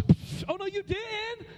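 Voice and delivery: mock beatbox psh into high sass; hammed-up schoolgirl attitude; sassy attitude. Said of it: "They'd hit me with a beatbox".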